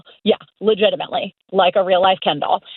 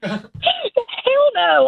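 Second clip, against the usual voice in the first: laughs it out high, real amusement; laughing. Real, not acted. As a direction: bursts out laughing as she denies it. Laughing at the suggestion.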